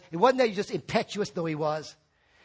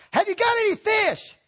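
higher, louder call across water; 12 semitones higher, 4 dB louder than usual. Retold or imitated. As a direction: call out to the boat from the shore. Customer calling to boats.

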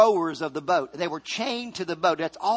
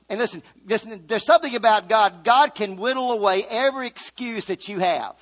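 ordinary talk, nothing marked

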